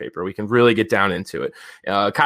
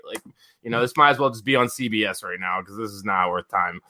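usual speaking voice

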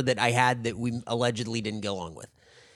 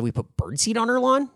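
higher questioning repeat, rising at the end; feigned puzzlement; 11 semitones higher than usual. Retold or imitated. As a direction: play dumb, echoing the accusation back as a question. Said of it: "just repeating it back".

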